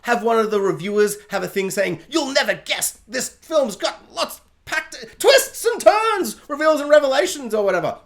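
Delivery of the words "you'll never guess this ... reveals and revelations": high, slow, hyped-up blurb voice; gushing reviewer. Acted. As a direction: proclaim it like a trailer pull-quote. Voicing a gushing review quote.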